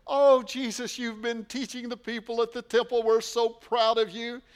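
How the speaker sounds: high, loud mock gush of pride; gushing proud mother. Mother praising Jesus after the temple, gushing high and loud. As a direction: play the proud mother gushing over her son. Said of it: "she doesn't come and say".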